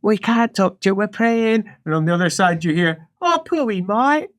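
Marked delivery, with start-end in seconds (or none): 0.0-1.6 higher-pitched, hushing initiate voice; praying initiates. Acted. Initiates answering a nosy patient, acted prim refusal. 3.2-4.3 high, cooing sympathy; pitying initiate. Acted. Initiate pitying the dead thing, acted cooing pity.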